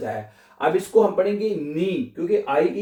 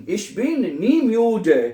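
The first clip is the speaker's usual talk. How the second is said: Ich komme nie zu spät, each word drawn out very slowly; slow dictation; 5 semitones higher, much slower than usual. Shown as a demonstration. Teacher dictating a German sentence to learners.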